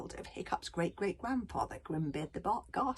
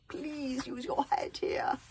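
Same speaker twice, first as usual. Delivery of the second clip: pleading son; 6 semitones higher, slower than usual. Acted. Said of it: "protested Hiccup".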